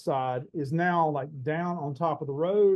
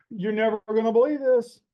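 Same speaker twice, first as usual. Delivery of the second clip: louder and higher, bursting with news; excited news; 6 semitones higher, 6 dB louder than usual. Retold or imitated. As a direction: blurt it out like huge news. Friend bursting with big news.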